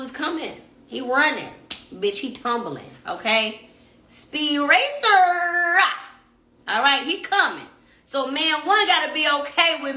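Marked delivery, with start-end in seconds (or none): 4.1-5.8 slow, high, loud "Speed racer"; hammed-up cartoon call; 1 drawn-out mock announcer. Real, not acted. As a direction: stretch it out like a race announcer. Mocking how fast he comes.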